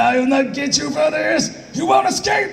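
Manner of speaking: very high, slow mock-furious shout; over-stretch Vegeta's threat in a rage; hammed-up dubbed fury; weird angry cadence. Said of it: "it's weird cadence".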